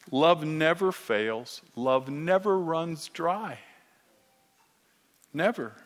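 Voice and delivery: ordinary talk, nothing marked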